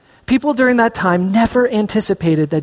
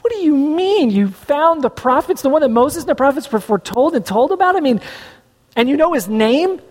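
incredulous crowd; 6 semitones higher than usual. Retold or imitated. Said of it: "everybody goes".

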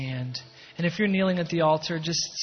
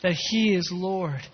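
louder, rising preacher's appeal; fervent preaching; 3 semitones higher, 4 dB louder than usual. Acted. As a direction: preach it out with full conviction. Preaching builds to climax.

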